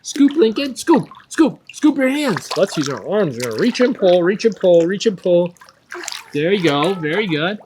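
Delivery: calling out swim cues, high and loud; echoey pool coach shouting. Real, not acted. Coach calling strokes to child.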